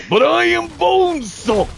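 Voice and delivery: high, slow hammed-up boast; mock Bonesaw boast. Friend parodies the Avengers line as Bonesaw, high and hammy. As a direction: parody a heroic catchphrase.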